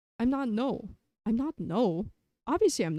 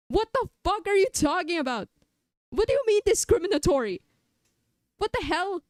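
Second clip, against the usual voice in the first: high loud indignant yell; outraged rant; 7 semitones higher, 3 dB louder than usual. Acted. Other self explodes at being called discriminatory. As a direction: blow up in disbelief.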